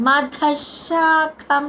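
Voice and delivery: slow మధశాకం, the visarga sounded as శ; మధశాకం with the visarga spoken as శ, aspirated ధ; visarga as sha. Shown as a demonstration. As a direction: say మధశాకం slowly, turning the visarga into a clear శ sound. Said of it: "అక్కడ విసర్గ ఉచ్చారణ ష".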